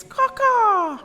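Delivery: high cawing bird call. Shown as a demonstration. Reading to children, imitating a bird's caw. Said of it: "I don't know what sounds a toucan makes".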